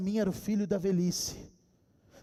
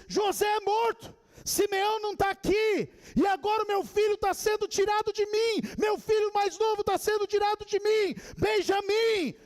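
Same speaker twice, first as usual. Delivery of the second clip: high, sustained preacher's cry; hammed-up high-pitched lament; anguished cry of Jacob; 10 semitones higher, 3 dB louder than usual. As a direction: cry out as a bereft father. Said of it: "Jacó então grita as palavras".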